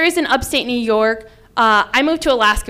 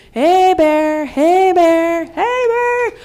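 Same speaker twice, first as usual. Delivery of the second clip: drawn-out call, climbing higher each time; screamed bear call; 7 semitones higher, 3 dB louder, much slower than usual. Retold or imitated. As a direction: call out to warn off bears, pitched high. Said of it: "I'm screaming".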